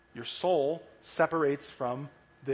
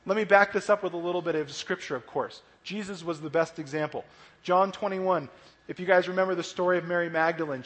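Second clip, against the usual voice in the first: normal talking voice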